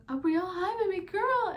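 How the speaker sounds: high, loud tender cry of joy; tender greeting. Retold or imitated. Mother greeting her newborn daughter, tender high cry. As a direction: greet the newborn with overflowing love. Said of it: "you can literally hear me saying that".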